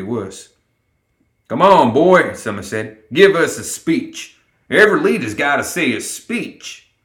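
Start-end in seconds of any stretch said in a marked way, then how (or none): 1.5-2.3 loud, high encouragement; raised, hearty prodding; urging. A narrator's reading. Summer urges Lenny before crowd. 3.1-6.9 higher-pitched rallying demand; hearty, raised encouragement; urging. A narrator's reading. Summer urges Lenny before crowd.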